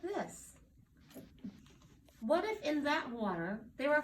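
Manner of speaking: lightly voiced mother, lifted and lilting; encouraging mommy. A narrator's reading. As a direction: gently talk your child into seeing it differently. Mommy encourages her scared child.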